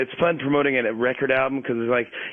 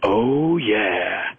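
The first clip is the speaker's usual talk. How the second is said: Brian Williams' smooth oh yeah; 2 semitones lower than usual. Retold or imitated. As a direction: croon "Oh yeah" like a smooth late-night news anchor. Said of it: "normally Brian Williams goes".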